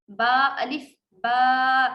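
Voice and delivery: با الف با spelled out slowly; slow spelling. Shown as a demonstration. Teacher spells for pupils: slow با الف با. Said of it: "تو وہ با پڑھا جائے گا".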